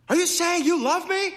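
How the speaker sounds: high, loud shout over traffic; yelled question. A narrator's reading. He can't hear her over passing traffic, yelling. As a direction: yell it up high, straining to hear. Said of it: "he yelled".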